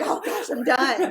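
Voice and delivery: mock relief. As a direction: play up being done, slow and loud, teasingly. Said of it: "Don't breathe a sigh".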